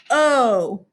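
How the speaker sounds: long O sound, saying the letter's name. Shown as a demonstration. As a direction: hold O as a long vowel, "oh". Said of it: "its long O says its name".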